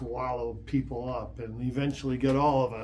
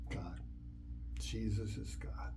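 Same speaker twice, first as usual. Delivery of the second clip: hushed whisper of revelation; 5 semitones lower, 12 dB quieter than usual. Real, not acted. Preacher telling the congregation the truth, in a hushed whisper. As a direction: breathe it as a sacred secret.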